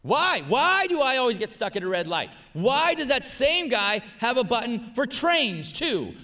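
high, loud mock whining rant; sarcastic self-pity. Preacher mocks his old self-pity, high loud sarcastic whine. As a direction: play the martyr the whole world is against. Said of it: "that's just a little bit of sarcasm".

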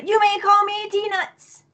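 high, slow, regal introduction; grand proclamation. Acted. Player redoing a ruined introduction for the party, high grand proclamation. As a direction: lift it high and stretch it out pompously. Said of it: "Do it again".

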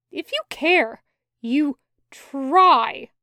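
angry lecture; heated. Acted. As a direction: press him hard. Loop scolding Kravitz.

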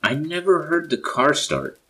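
loud, high, alarmed shout; high-pitched urgent holler; calling out. A narrator's reading. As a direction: call out to get everyone's attention. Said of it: "Aaron called out for our attention".

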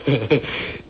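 a short, knowing laugh; sly laugh. Acted.

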